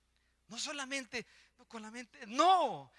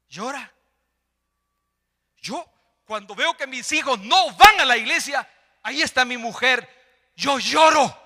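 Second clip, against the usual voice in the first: loud, raised-pitch sermon cry; full-force preaching; 7 semitones higher, 18 dB louder than usual. Real, not acted. Preacher confessing grief over his children, full-force preaching.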